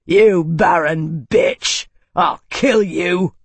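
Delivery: slow, loud, enraged threat; furious roar. A narrator's reading. Cord roaring hatred at Isana, slow and loud. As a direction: roar it with murderous rage. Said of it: "Cord let out a furious roar".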